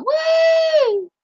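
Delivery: high cheering woo; excited whoop. Real, not acted. Streamer cheers the score to chat, high whoop.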